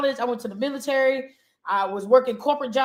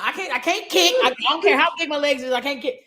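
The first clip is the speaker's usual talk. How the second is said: normal talking voice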